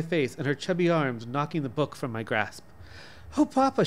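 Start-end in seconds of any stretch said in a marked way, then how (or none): 3.4-3.8 put-on high, bright excited child; enthusiastic. A narrator's reading. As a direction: cry out with childish delight. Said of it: "she cried in enthusiasm".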